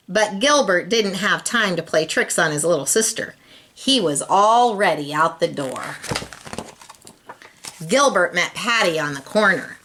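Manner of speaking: ordinary delivery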